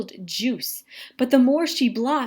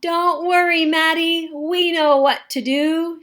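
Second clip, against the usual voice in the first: higher, louder call from across the room; shouting across room; 6 semitones higher, 4 dB louder than usual. A narrator's reading. Friends calling across the classroom to stuck Maddie, loud and eager. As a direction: reassure him from far away, loud. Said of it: "shout Luke and Grace from across the room".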